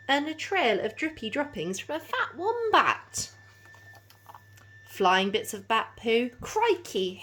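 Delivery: lively storybook read-aloud; animated picture-book narration; reading to children. A narrator's reading. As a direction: bounce the rhymes for little listeners.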